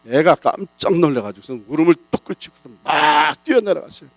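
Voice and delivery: normal talking voice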